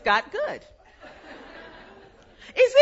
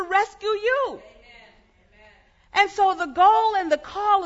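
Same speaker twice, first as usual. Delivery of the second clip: rising, louder sermon delivery; preaching; 6 semitones higher, 4 dB louder than usual. Acted. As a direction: build the congregation up with fervour. Preaching builds to full force.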